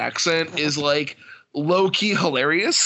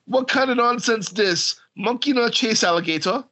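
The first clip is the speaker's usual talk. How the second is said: higher, mock Jamaican patois line; light Jamaican accent, higher; Jamaican idiom quote; 6 semitones higher than usual. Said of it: "the idioms that they use".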